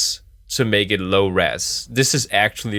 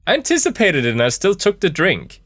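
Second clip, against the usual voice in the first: high, excited disbelief; 9 semitones higher, 4 dB louder than usual. Real, not acted. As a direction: blurt it out in amused disbelief. Reacting to being drugged.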